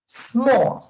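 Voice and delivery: "Small" in one beat, s joined straight to m; single syllable. Shown as a demonstration. Teacher demonstrating one syllable, "Small" with s and m run together. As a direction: say "Small" with no vowel before the s. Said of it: "Cuántas sílabas son? Una".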